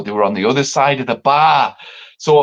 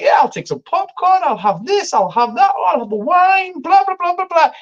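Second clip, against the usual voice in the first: much higher, airy mock voice of the demanding date; mock posh date. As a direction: send her up as a spoilt rich girl. Mimicking rich date ordering.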